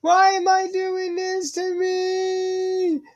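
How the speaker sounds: slow, high drawn-out lament; mock wail. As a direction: wail it out like a hammed-up victim. Falling again, wailing.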